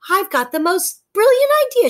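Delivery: high and loud, bursting with the idea; excited exclamation. A narrator's reading. Fairy Nixie tells Brogden her idea, excited and loud. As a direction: blurt it out, thrilled. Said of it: "she exclaimed".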